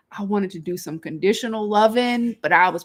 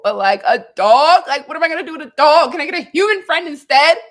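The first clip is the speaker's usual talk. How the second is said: louder and higher, exasperated disbelief, faster; exasperated protest; 8 semitones higher, 9 dB louder, faster than usual. Real, not acted. Speaker protesting to God over a dog, exasperated.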